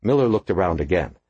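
ordinary delivery

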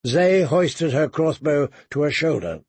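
usual speaking voice

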